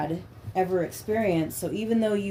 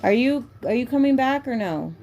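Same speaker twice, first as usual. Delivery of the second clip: calling out; 7 semitones higher, 4 dB louder than usual. Real, not acted. Calling out to someone elsewhere.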